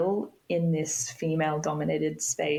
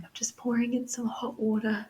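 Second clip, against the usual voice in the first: gentle mum; 5 semitones higher than usual. Retold or imitated. Mum pours hot water into the birth pool, tender voice. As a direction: soothe her daughter tenderly. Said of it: "she'd say".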